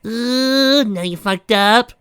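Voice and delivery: high, loud, slow gleeful crow; crowing taunt. Acted. Pinky taunting the villain, gleeful crow. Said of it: "She suddenly crowed".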